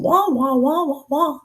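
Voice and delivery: high, swooping wah-wah drone; cartoon teacher. Shown as a demonstration. Trainer repeats the old cartoon teacher bit, high wah-wah. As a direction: drone wordlessly, bending each syllable up high. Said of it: "The teacher".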